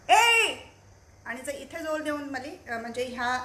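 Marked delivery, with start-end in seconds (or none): 0.1-0.5 ए! yelled much higher and louder, strained from the throat; a short yell pushed from the throat; throat shout. Shown as a demonstration. Voice therapist demonstrating the wrong throat-strained shout. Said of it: "जर मी इथे जोर देऊन बोलली तर कसं होईल".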